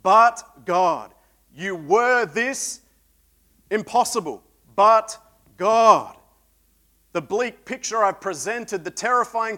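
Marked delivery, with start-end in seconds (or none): 0.0-1.1 loud, weighty proclamation of two words; emphatic declaration. Real, not acted. Preacher to congregation, a loud emphatic proclamation. 4.8-6.2 louder, higher, each word hammered; emphatic declaration. Real, not acted. Preacher repeating the turning point, loud and hammered.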